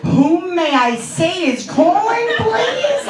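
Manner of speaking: slow, grandly formal phone answer; mock-genteel, rising high on the last word; lady of the home. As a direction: draw it out like a lady of the manor. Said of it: "like, the lords and lady of the, of the home".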